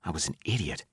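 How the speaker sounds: ordinary delivery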